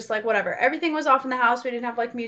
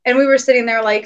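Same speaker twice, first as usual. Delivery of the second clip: ordinary talk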